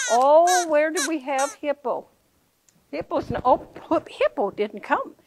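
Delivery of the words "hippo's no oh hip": usual speaking voice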